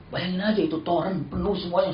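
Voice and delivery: usual speaking voice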